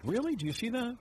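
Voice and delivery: high-pitched mock-woman voice; imitated woman. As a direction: put on a high, surprised female client. Voicing women flattered by hairdresser.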